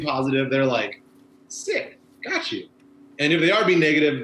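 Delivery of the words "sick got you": much higher, light voice; approving reply. Retold or imitated. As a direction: imitate a pleased driver's quick approval. Imagined positive driver's reply.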